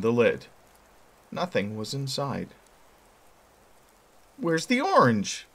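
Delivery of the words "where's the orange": put-on demanding boy. A narrator's reading. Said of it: "he demanded".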